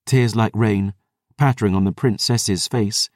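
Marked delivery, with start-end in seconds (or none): none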